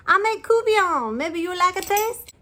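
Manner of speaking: high, cooing giant lady. A narrator's reading. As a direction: coo down at the tiny boy. Giant lady offers food.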